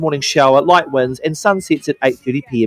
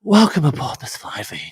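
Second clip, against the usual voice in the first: mumbled flight attendant voice; under the breath; 2 semitones lower, 5 dB quieter than usual. Retold or imitated. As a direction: mumble the safety spiel. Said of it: "do it under their breath".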